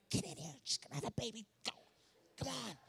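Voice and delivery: hushed, voiceless urging; urgent mock whisper. Pastor beckoning assistant through the window, urgent hushed whisper. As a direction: mouth it urgently, barely any voice.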